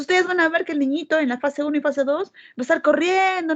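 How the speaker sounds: ordinary delivery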